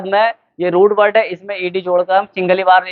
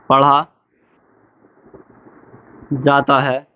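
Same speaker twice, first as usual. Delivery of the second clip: low voice, long pauses between words; word-by-word dictation; 5 semitones lower, much slower than usual. Shown as a demonstration. Teacher writing out the definition for the children, slow dictation. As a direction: dictate it slowly, a word or two at a time. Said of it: "मैं पहले लिख दूँ उसकी परिभाषा".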